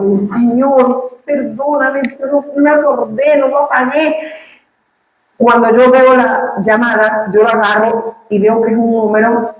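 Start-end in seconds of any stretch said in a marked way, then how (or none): 0.3-4.2 high, pleading apology to the Lord; a hint of anguish in a retold prayer; pained plea. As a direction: beg forgiveness in a strained high voice. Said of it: "me dio como un dolor".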